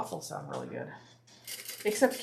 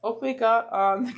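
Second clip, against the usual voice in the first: usual speaking voice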